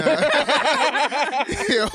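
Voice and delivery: high burst of loud laughter. Real, not acted. Host laughs hard at his friend's complaint, loudly. After asking if story boring.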